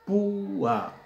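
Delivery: "Púa" with a high, loud, long ú; hiatus in púa. Shown as a demonstration. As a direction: draw out PÚ, then a separate a. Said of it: "vocal cerrada acentuada".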